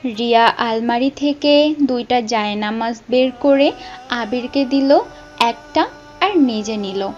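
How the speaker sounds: ordinary delivery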